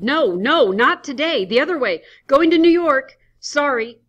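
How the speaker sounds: high, loud desperate panic; sweating blood. Acted. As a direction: push the words out in terror. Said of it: "Adams, as though sweating blood".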